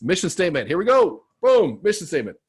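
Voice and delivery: excited find. Real, not acted. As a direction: call it out like you just struck gold.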